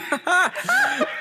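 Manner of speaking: high mechanical laugh again; sailor dummy laugh. Acted. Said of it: "and he laughs at them".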